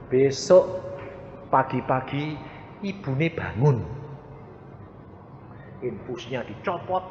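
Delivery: usual speaking voice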